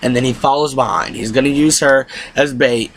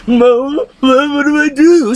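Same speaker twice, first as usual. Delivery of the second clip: put-on high, slow whining child voice; whiny kid; 12 semitones higher, 4 dB louder, slower than usual. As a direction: whimper it like a helpless little boy.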